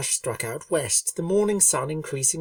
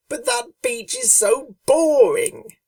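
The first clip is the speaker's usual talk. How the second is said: higher, louder whiny pony voice, slow; complaining Rainbow Dash; 12 semitones higher, 6 dB louder, slower than usual.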